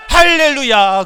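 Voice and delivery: high, loud hallelujah cry; rousing shout. Real, not acted. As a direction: belt it out to rouse the congregation. Preacher shouts to rouse congregation. (높고 우렁차게 외치는 환호)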